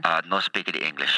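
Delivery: thick put-on foreign accent; hammed-up broken English. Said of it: "I went to a Russian accent there".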